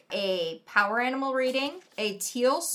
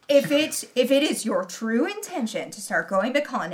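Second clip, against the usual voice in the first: ordinary talk, nothing marked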